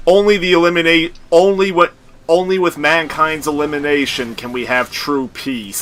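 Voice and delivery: loud, high, cold robot declaration; booming killer-machine pronouncement; T-1000 voice. Retold or imitated. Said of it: "T one thousands was like".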